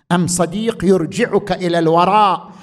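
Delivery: ordinary talk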